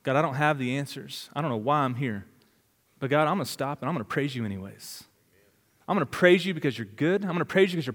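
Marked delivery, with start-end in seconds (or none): 0.0-5.0 hushed, humble prayer to God; quiet prayer. Pretend. Preacher voicing a beaten prisoner's soft prayer to God. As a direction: pray it softly, broken but trusting. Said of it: "Can you stop and be like".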